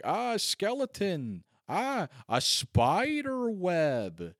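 sarcastic gasp at cheap decorations; mock unimpressed. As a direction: drag out each ah as if bored. Mocking cheap haunted house.

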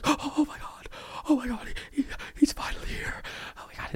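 breathless high whisper; awed whisper. Pretend. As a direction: whisper in hushed awe. Congregation whispers in awe.

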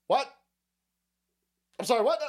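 feigned shock at the aid news; mock disbelief. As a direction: pretend you misheard.

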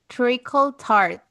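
ordinary talk, nothing marked

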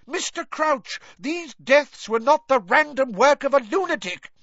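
very high, outraged protest; crying out angrily. A narrator's reading. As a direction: cry out each name in anger. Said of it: "Professor McGonagall cried".

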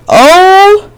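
sudden high cry of oh; high dramatic oh. Acted. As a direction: belt out a high oh. Dramatic exclamation.

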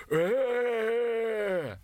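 high troll yell; troll shout. A narrator's reading. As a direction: jump out and yell.

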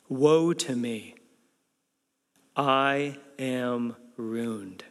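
slow, low, solemn lament; acted awe-struck despair; humbled dread. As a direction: draw it out gravely, as one humbled to the core. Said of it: "he is humbled to the core".

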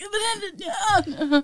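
high, strained laugh almost a cry; laughing at herself, nearly crying; helpless laugh over her own frustration. Real, not acted.

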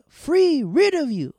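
high, loud, exclaiming movie impression; hammed-up cry of release; Tin Man. Pried-out character in The Wiz, hammed-up high exclamation. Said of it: "It was like".

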